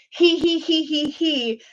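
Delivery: a light, imitated schoolkid snicker; mock giggle. Classmates snickering at a French word, mock giggle. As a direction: titter like kids at a rude word. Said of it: "we all used to laugh and be like".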